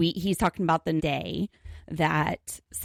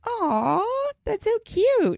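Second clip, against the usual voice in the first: cooing; much slower than usual. Real, not acted. Cooing over a friend's tattoo.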